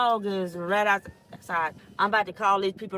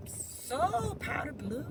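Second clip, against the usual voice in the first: dreamy admiring; 7 semitones higher, 6 dB quieter, much slower than usual. Real, not acted. Admiring sky.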